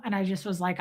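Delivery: ordinary talk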